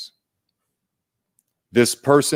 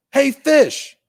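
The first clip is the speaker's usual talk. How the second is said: much higher, louder playful fox voice; cool fox greeting; 5 dB louder than usual. Acted. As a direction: call out to the fish like a breezy cartoon fox. Fox greets fish third time.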